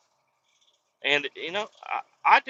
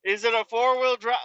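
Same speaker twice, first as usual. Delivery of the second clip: higher, lightly voiced nosy clerk; mocking clerk; 6 semitones higher than usual. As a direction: mimic the pointless questions. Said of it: "It's like".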